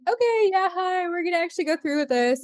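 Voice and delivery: high, quick excited greeting; nervous eager hi. Retold or imitated. Calling the psychic back, high and nervously eager. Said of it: "we're like".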